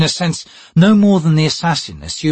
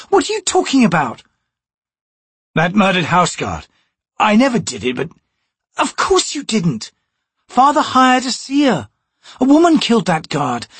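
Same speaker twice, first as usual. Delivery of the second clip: scornful noblewoman; 13 semitones higher than usual. Acted. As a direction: talk down to the thief. Chalice, scornful.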